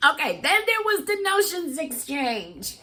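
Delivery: high, excited lead-in; excited announcement. Real, not acted. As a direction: pitch it up high with giddy excitement. Excited announcement of big gift swap.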